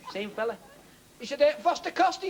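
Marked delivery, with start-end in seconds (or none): 1.4-2.2 higher, louder German tourist voice; the German asks the price, hammed up; mock German accent. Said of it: "This German fella".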